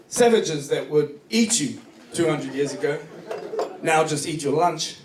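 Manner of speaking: ordinary delivery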